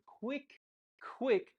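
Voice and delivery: "quick, quick" said slowly and stretched; a deliberately slow "I counted quick, quick". Shown as a demonstration. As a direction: draw out "quick, quick" to tell the brain slow down. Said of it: "to tell my brain, slow down".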